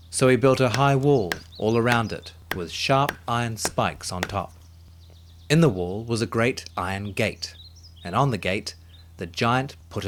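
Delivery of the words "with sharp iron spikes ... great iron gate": usual speaking voice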